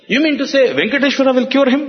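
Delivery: fast, high-pitched retold disbelief; incredulous questioning. Father to holy man about Venkateshwara's cure, incredulous. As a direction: race through it as a scoffing father.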